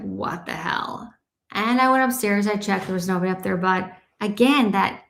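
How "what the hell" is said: whispered, drawn-out retold reaction; soft breath of mock shock; hushed disbelief. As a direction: whisper it slowly, as if stunned. Retold shocked exchange with son.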